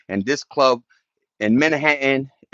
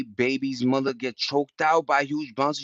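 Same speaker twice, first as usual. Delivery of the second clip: ordinary talk, nothing marked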